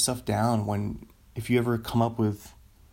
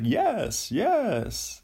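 Jeff Goldblum impression; 8 semitones higher than usual. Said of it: "he talks like".

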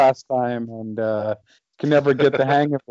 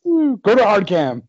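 mock camera order; 7 semitones higher, 4 dB louder than usual.

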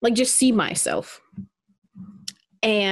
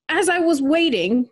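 ordinary talk, nothing marked

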